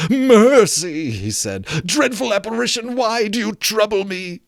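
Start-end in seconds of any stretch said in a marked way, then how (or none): 0.1-1.1 high, loud terrified plea; begging for mercy. Acted. Scrooge on his knees before the ghost, terrified plea. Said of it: "Scrooge fell upon his knees". 1.8-4.4 higher, trembling plea; frightened Scrooge. Acted. Scrooge begging the apparition, higher frightened voice.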